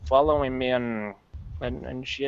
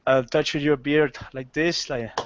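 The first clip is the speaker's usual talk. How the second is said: normal talking voice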